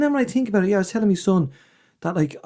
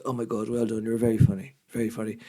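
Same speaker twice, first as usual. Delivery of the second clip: Charles; 5 semitones lower, 6 dB quieter than usual. Retold or imitated. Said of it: "Charles went".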